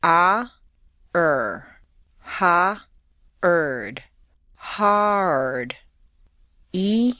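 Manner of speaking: each vowel held full, then a throaty "er". Shown as a demonstration. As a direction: separate vowel and "er" sounds. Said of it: "Repeat each sound, first the vowel, and then the er".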